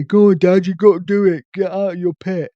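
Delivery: a teasing son telling Dad off; imitating son. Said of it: "my son will probably tell me off".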